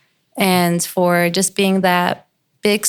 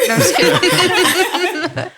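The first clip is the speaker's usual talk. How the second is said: laughing; 11 semitones higher, slower than usual. Real, not acted. Laughing after teasing joke.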